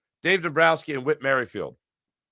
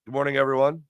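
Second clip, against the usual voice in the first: ordinary talk, nothing marked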